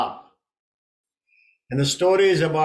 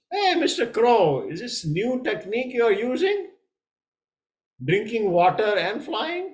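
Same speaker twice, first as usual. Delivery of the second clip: teasing swan; 9 semitones higher, 4 dB louder than usual. Acted. Swan teasing the sinking crow.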